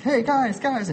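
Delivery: put-on high, bright calling of a small boy; eager little boy. Father imitating his son calling the older kids, high and eager. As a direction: call out like a five-year-old wanting in.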